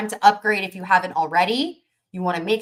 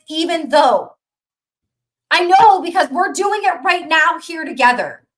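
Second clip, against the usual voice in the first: louder and higher, building motivational rant; fired-up preaching; 7 semitones higher, 6 dB louder than usual. Acted. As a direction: hype them up like a preacher.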